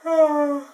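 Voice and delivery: big, loud, sleepy yawn; a drowsy possum yawning; yawning sigh. Acted. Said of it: "And he yawned".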